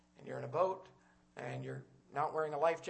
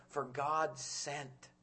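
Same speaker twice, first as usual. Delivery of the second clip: slow quote; much slower than usual. Shown as a demonstration. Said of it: "Jesus said".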